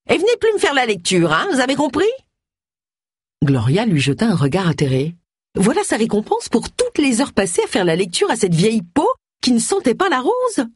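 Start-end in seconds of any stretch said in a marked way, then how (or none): 0.1-2.0 high-pitched scolding rebuff; indignant. Acted. Old villager banning the busybody from her house, high and indignant. 5.5-10.7 high-pitched inner grumbling; Gloria, aggrieved. Acted. Busybody resenting the old woman she read to, high and aggrieved. Voices Gloria's indignant thought.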